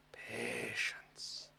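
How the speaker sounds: low breathy whisper. Pretend. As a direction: hush it like a wise old master. Mock wise master advice.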